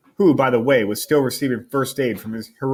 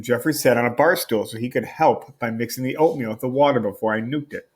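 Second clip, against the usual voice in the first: ordinary delivery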